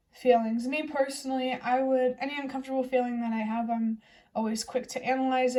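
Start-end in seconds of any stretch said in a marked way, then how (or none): none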